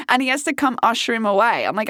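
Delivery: normal talking voice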